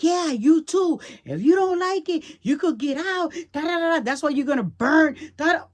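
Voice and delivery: high, loud, furious shouting in the son's voice; son's angry yell. Retold or imitated. Retelling how her son yelled at her, mock furious high shout.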